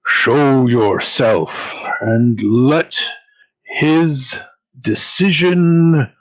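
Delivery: slow, booming divine summons; booming god voice. Acted. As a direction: boom it out slowly like a god. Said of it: "you hear a booming voice".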